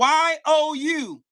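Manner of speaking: letters drawn out slowly; mocking spell-out, letter by letter; slow taunting spell-out. Real, not acted.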